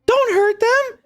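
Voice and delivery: put-on high, pleading young girl. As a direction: beg your aunt to spare them. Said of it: "Saley speaks up".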